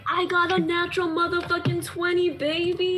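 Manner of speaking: high, loud gleeful brag; real excitement. Real, not acted. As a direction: crow over the natural twenty. Celebrating natural twenty roll.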